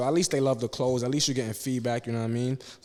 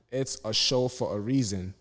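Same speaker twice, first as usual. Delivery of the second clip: normal talking voice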